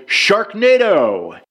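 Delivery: high, stretched-out call; yelled. A narrator's reading. Someone sets the campers spinning, high drawn-out yell. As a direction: cry it out slowly with a falling end. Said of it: "Someone yelled".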